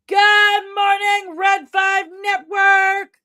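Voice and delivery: drawn-out shouted welcome; greeting a viewer by name, sing-song and loud; high, slow, loud sing-song greeting. Real, not acted. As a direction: stretch out a big cheery hello.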